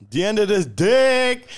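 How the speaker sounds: drawn-out, high, loud gotcha; gleeful punchline. Real, not acted. Springing a dick joke on a friend, gleefully loud. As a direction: stretch it out and shout it in triumph.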